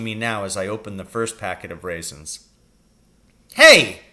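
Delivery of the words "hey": sudden loud high cry. A narrator's reading. Father cries out on seeing his son's hand, loud. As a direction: shout it out in alarm. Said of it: "he cried".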